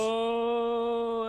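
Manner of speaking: drawn-out swooning oh; mock moan. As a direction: hold a hammed-up excited moan. Mock arousal at the pour.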